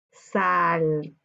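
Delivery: drawn-out "Saltaba", low sal and long lifted TA; stressed syllable stretched. Shown as a demonstration. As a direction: load the voice onto the TA of "Saltaba". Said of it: "Su sílaba tónica".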